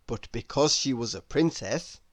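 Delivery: ordinary delivery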